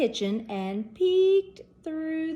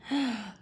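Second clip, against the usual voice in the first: gasp; 5 dB quieter than usual. Acted. Shocked gasp.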